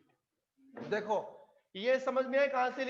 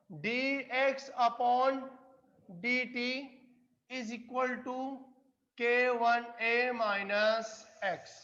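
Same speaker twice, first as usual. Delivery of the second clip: very slow, each term set apart; slow dictation; much slower than usual. Real, not acted. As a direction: dictate it term by term with long pauses. Teacher spelling out formula for board. (धीरे-धीरे बोलकर लिखवाते हुए)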